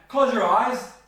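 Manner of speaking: loud, high "Close your eyes"; command. Shown as a demonstration. Therapist showing a command version, loud. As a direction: snap it out as an order. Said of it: "into a command".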